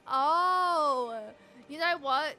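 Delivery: ordinary talk, nothing marked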